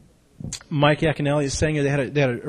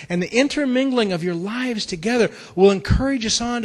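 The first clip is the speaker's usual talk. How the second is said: raised, fervent preaching; 8 semitones higher, 4 dB louder than usual. Acted. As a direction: preach it with passion, loud. Preaching sermon.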